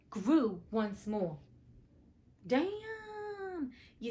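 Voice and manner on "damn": high amazed exclamation; a squeal of wonder at the miracle; amazed damn. Real, not acted. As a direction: react in sheer disbelief. Reacting to hands regrowing.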